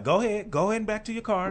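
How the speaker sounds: high, loud mock taunt; a hammed-up imitation of her taunting the officer; mocking the woman. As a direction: throw it back sarcastically like her. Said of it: "she was still like".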